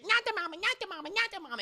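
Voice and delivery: high-pitched repetitive creature squawk; cartoonish squealed attack cry; baby creature. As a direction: chant it like an attacking baby monster. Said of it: "the creature's like".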